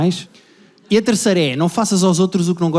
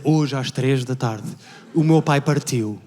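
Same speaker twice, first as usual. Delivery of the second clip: solemn post; 4 semitones lower, much slower than usual. Retold or imitated. Mourner posting about his father online, slow and solemn. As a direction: read it like a grave tribute. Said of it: "uma foto a preto e branco do pai a dizer".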